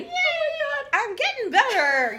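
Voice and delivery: high, loud laughing yay; delighted cheer. Real, not acted. Co-host praised ten out of ten, laughing delight. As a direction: celebrate the compliment with a laugh. Praised for her facial expressions.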